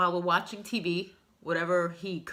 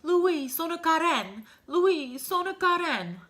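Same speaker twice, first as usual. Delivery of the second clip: much higher, slow, strained call in Italian; father's plea in Italian; 9 semitones higher, 4 dB louder, slower than usual. Retold or imitated. Frail father calling his son from the couch, high slow Italian plea. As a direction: imitate a frail old father calling out for help. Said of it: "and he's like".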